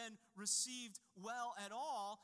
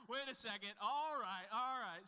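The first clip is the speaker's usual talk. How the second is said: louder, startled wait-a-second catch; sudden realization; 2 semitones higher, 7 dB louder than usual. Retold or imitated. Dad retelling daughter's realization, startled and louder. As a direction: caught out mid-step, it dawns on her.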